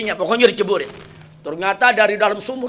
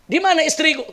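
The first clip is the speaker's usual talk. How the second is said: startled question; 8 semitones higher than usual. Retold or imitated. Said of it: "Kaget ini orang".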